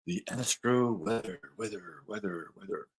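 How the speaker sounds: the title repeated and fading away like an echo; echo effect. Acted. Host opening the astro weather segment, fading echo. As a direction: announce the segment and let the last word echo off.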